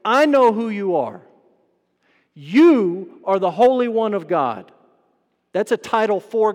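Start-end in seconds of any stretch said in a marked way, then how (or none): 0.0-4.7 loud, raised declaration, leaning hard on YOU; demon's loud proclamation. Retold or imitated. Demon moving a man to speak up in church, loud proclamation. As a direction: proclaim it loudly to the whole room. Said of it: "he moves him to say".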